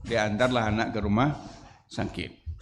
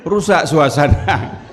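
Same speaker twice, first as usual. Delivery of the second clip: laughing punchline; 3 semitones higher, 8 dB louder, slower than usual. Real, not acted. Slow loud laughing punchline.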